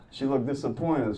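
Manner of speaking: ordinary delivery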